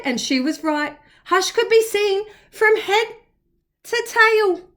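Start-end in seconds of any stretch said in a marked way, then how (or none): none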